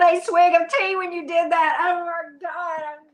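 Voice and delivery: high, slow, breathless with laughter; laughing helplessly. Real, not acted. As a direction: crack up after nearly spitting out tea. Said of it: "I'm dead".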